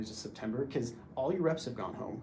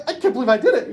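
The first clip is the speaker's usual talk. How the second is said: retold freak-out, much higher, louder and faster; 11 semitones higher, 14 dB louder, faster than usual. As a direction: blurt it out in acted disbelief. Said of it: "I start freaking out".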